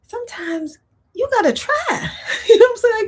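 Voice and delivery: high laughing remark. Real, not acted. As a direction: laugh while you say it. Laughs at own point.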